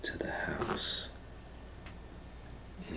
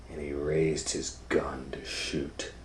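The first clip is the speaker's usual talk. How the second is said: plain reading voice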